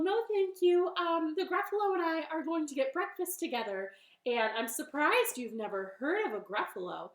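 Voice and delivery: high, loud, hurried bunny; bunny character. Acted. Bunny declines owl's dinner invitation, high and loud. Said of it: "And the bunny said".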